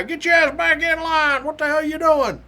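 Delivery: high-pitched mock drill yell; imitated trooper's yell. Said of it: "He's yelling at this guy".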